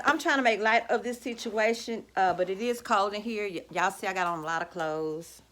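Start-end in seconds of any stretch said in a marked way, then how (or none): none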